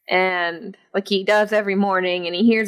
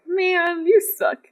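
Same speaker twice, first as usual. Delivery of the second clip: higher, teasing put-down; mock disgust; 9 semitones higher, slower than usual. Viewer mocking two men nodding, playful high disdain. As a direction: sneer it playfully at the screen. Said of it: "it's like".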